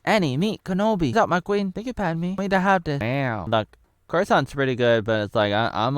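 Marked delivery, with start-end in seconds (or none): 0.0-3.0 high put-on character voices; mock introductions. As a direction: play the greetings high and cartoonish. Acted introductions in film parody.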